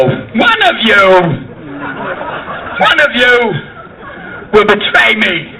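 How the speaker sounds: slow, halting delivery with odd long pauses; Chris Walken. Acted. Walken as Jesus at supper, slow halting impression. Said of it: "see Chris Walken play Jesus".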